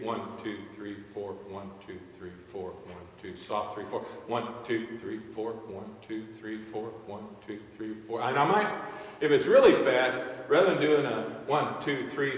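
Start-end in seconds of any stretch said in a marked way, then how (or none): 0.0-8.2 softer, lower count in tempo; quiet steady counting. Shown as a demonstration. Conductor counting beats to show tempo, quietly. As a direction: keep the count even and quiet. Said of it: "how fast you do your beat".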